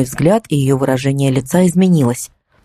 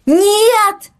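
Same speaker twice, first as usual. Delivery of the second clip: desperate scream. Acted. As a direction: scream no as she jumps. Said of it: "закричала я". (отчаянный крик)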